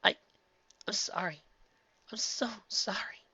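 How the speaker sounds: high, soft whispered apology; tearful whisper. A narrator's reading. Twilight whispering her apology to Spike. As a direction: fade into a whisper.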